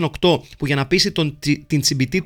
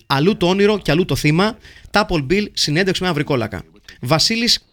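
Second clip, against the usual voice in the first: ordinary talk, nothing marked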